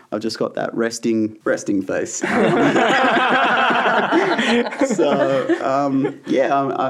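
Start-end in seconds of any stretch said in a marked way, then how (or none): 2.3-4.8 a long, loud, high burst of real laughter; big real laugh. Real, not acted. Guest laughing at his own angry-looking face, long loud high laugh. 4.9-6.9 laughing through words. Real, not acted. Still laughing, picking thread back up.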